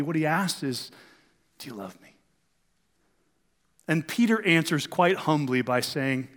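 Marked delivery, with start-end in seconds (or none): 1.6-2.1 hushed question. Acted. Preacher voicing Jesus asking Peter, very soft. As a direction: drop almost to a whisper-soft question.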